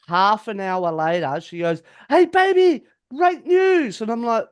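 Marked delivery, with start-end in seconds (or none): 2.1-4.0 high, loud, gushing mock squeal; gleeful girlfriend. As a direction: imitate her bursting with happy news. Mimics girlfriend's gleeful announcement.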